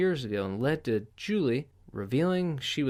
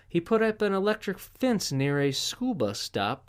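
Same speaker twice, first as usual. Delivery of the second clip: ordinary talk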